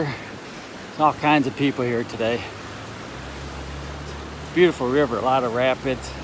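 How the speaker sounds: windy on a bridge. Real, not acted.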